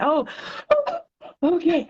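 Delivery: a pretend sob on the high oh, then relief; hammed-up crying that settles; overwhelmed reader crying at a poem, mock sob; mock emotional breakdown. Stressed reader finds relief in poem.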